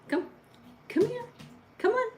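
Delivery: very high coaxing voice; squeaky call to the dog. Real, not acted.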